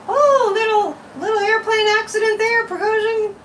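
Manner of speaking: high mock-innocent voice; high, falsely sweet sarcasm; sarcastic. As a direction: sing it sweetly with fake innocence. Sarcastic aside about Putin.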